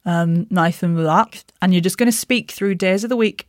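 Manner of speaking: usual speaking voice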